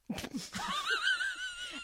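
very high squeal of laughter; high helpless cackle; shrieking laughter. Real, not acted. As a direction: burst into a piercing laugh. Laughing at the joke answer.

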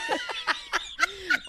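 squeal of laughter. Real, not acted. Laughing fit.

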